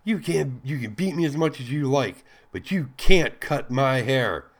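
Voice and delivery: hissed. A narrator's reading. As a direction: spit it back at him in defiance. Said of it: "She hissed".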